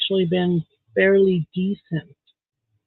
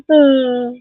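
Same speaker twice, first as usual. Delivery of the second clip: a high, breaking sigh that catches in the throat; tearful sigh; 10 semitones higher, 4 dB louder than usual. Real, not acted. Venting about her country, a real tearful sigh. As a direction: let real frustration crack into a shaky uh. Upset over politics, voice breaks.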